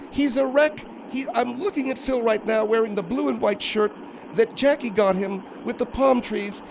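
high, soft woman's character voice; put-on high voice, appalled; disapproving Margaret. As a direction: deliver a high-voiced, withering report. Margaret character describes Phil.

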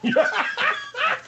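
very high burst of laughter; laughing. Acted. Laughing at the General walking it off, high laugh. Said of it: "What are you laughing at".

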